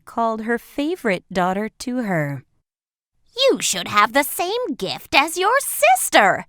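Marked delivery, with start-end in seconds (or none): 3.4-6.5 higher, louder eager mother; eager widow. A narrator's reading. Widow urging her favorite daughter, higher and louder. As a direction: push it out bright and keen, like a greedy mother. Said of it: "said the widow".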